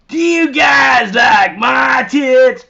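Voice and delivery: a loud, slow mock proposition; skanky woman's voice. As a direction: ham up the crude toothless woman. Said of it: "That's how she sounded".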